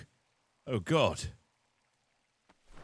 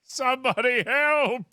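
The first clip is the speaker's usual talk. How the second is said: loud, higher call for help; 10 semitones higher, 4 dB louder than usual. Acted. Stuck in the room, yelling for help. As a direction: shout it out to anyone near.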